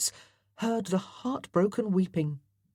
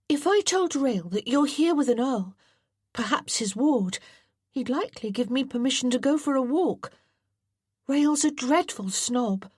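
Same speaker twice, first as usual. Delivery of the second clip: put-on higher boy's voice, thinking aloud eagerly; scheming boy John; 8 semitones higher than usual.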